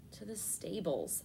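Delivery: slow, soft and sleepy; drowsy mumble. A narrator's reading. Answering the worker half asleep, slow and soft. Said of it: "I said sleepily".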